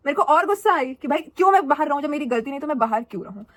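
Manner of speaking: ordinary delivery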